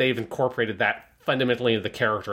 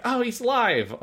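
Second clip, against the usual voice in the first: much higher, mock amazed gasp; relieved surprise; 11 semitones higher than usual. Imitating the crowd spotting the host, mock surprise. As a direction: play the crowd finding him alive. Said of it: "all the people are like".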